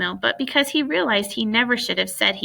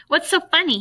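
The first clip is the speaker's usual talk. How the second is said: higher, pointed demand; 8 semitones higher than usual. A narrator's reading. Bradley demanding why his sister laughs. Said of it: "he demanded".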